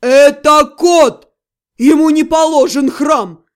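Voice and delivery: very loud, slow outraged roar; demon's bark. A narrator's reading. Demon objecting to temple for his cat, barking. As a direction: roar it word by word. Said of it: "рявкнул Ёшка".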